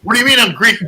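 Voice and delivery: ordinary talk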